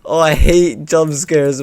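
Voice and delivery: normal talking voice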